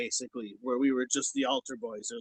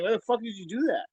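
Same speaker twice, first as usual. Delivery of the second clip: pitched-up outraged question; indignant quote; 6 semitones higher than usual. Retold or imitated. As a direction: ask it like you can't believe it. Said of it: "people were questioning him, like".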